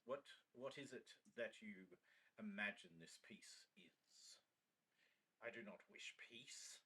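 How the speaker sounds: hushed, menacing wolf lord; a predator toying with its guest. Acted.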